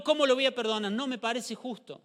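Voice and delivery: a hint of a resentful churchgoer; sleepy believer's complaint. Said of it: "Cuando estamos espiritualmente dormidos".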